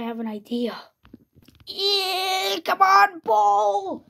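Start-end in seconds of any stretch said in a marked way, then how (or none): 1.7-4.0 high, drawn-out battle call; play-acted eager yell; rallying cry. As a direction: call out high as you charge in.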